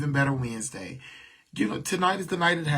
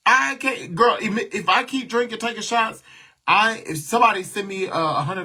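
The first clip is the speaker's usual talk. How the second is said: excited rant; 8 semitones higher, 8 dB louder than usual. Real, not acted. Tipsy rant about drinking.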